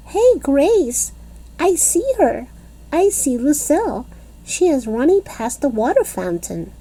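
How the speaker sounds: put-on high, bright-eyed kid voice calling to her friend; a little girl pointing and calling out; excited little girl calling out. A narrator's reading. Junie B calling to Grace that she spotted Lucille, excited.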